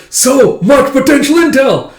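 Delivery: high, loud, frantic overexcited rush; freaking out. Retold or imitated. Loid to himself, freaking out over new contacts, frantic and high. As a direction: gush over all the contacts in a frenzy. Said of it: "Laurie's just freaking out".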